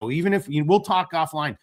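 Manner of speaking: ordinary delivery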